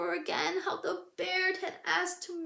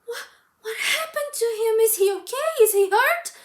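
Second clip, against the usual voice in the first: higher and much louder, panicked questions; alarmed cry; 9 semitones higher, 15 dB louder than usual. Acted. Girl cries out in alarm at the bear lying still. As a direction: cry out in fear for him. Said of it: "gasped and said".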